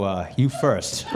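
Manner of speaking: drawn-out, deadpan comeback said at half speed; slow comeback. Retold or imitated. Comedian to heckler, slow deadpan comeback. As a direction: take your time, let it land slowly. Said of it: "comeback to heckler, slow".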